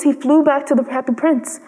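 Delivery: ordinary delivery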